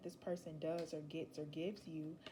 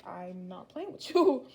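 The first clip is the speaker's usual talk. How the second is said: firm insistence; 13 dB louder, slower than usual. Acted. Acted partner insisting firmly.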